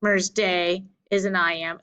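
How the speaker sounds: ordinary talk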